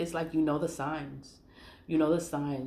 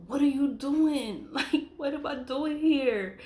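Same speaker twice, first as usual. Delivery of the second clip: much higher, confused and lost; bewildered; 10 semitones higher, 3 dB louder than usual. Retold or imitated. Lost under a love spell abroad, a high bewildered voice. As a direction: sound dazed by the spell.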